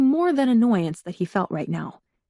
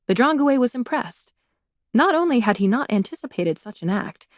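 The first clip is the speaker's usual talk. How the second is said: ordinary delivery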